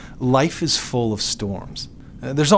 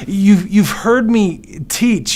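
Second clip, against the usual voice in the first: higher, louder, slow pleading; impassioned rebuke; 8 semitones higher, 6 dB louder, much slower than usual. Acted. Jesus pressing his frightened disciples, impassioned. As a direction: press them hard, almost shouting.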